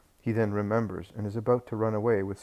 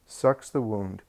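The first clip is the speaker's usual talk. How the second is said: plain reading voice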